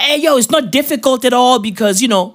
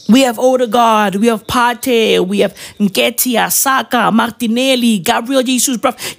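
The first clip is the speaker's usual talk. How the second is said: ordinary delivery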